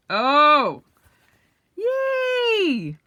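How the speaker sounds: ringing high yay; excited cheer. Real, not acted. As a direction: squeal of joy. Reacting to the cover.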